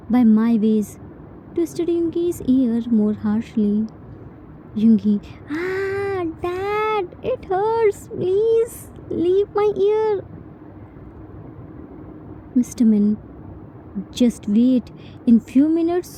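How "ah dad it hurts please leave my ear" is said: high, slow whine of mock pain; hammed-up pained whine, much higher; whining in pain. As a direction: beg dad to let go, whimpering. Son's ear being twisted.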